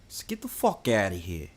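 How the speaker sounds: low, soft New York model line; New York accent demo. Shown as a demonstration. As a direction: show how a New Yorker says it. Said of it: "do it again. Let me try and copy".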